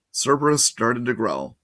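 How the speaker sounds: ordinary delivery, nothing marked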